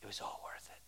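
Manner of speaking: breathless unvoiced whisper, barely audible; hushed whisper of hope; whispered promise. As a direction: whisper it like the last word of a prayer. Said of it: "we're gonna say".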